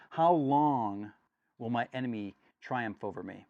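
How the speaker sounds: loud, pleading psalm reading; scripture reading. Acted. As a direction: cry out the psalm to God.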